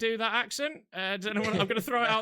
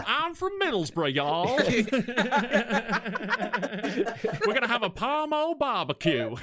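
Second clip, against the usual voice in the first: mock Texan Middlesbrough mix; 4 semitones higher, 3 dB louder than usual. Teasing the Middlesbrough lad raised in Texas, mock Texan accent. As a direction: put a cowboy Texan twang on it. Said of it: "this dude from Middlesbrough just said, "Howdy".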